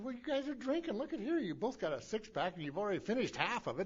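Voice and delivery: voicing Frank as he turns down the request; a lifted, knowing Frank voice; Frank's voice. Quoting sponsor Frank's refusal.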